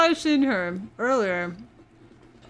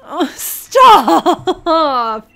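loud protest; 5 semitones higher, 12 dB louder than usual. Real, not acted. Playful protest at teasing.

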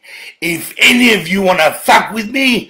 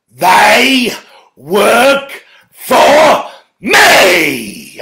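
very slow and loud, each word stretched; slow defiant shout; 4 semitones higher, 4 dB louder, much slower than usual. Real, not acted. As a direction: hammer home who is in charge. Defiant boast to the agencies.

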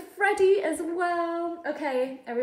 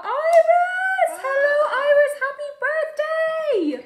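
excited birthday greeting; 10 semitones higher, 4 dB louder than usual. Real, not acted.

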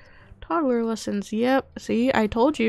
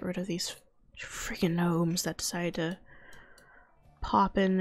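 annoyed whisper; 3 semitones lower, 5 dB quieter than usual. Real, not acted. Annoyed at gnomes in game.

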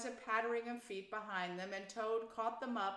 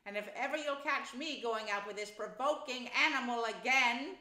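loud, indignant rising vow; snorted; 4 semitones higher, 6 dB louder than usual. A narrator's reading. Rat swears off the provoking animal, snorting. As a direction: snort it out in exasperation. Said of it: "he snorted".

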